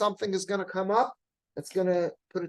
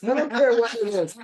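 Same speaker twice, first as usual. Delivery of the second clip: emphatic exhortation; 5 semitones higher, 7 dB louder than usual. Retold or imitated. Rabbi exhorts the whole yeshiva.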